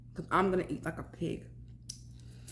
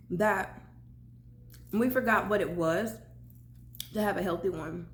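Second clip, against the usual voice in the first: ordinary delivery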